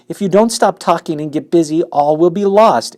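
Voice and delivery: loud, high desperate plea; in desperation. Acted. Pig begs the rat in desperation, loud. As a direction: beg with everything. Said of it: "said Wilbur in desperation".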